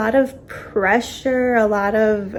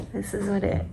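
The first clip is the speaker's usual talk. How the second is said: soft unvoiced whisper; 10 dB quieter than usual. Real, not acted. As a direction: whisper it so he stays asleep. Quiet aside near sleeping baby.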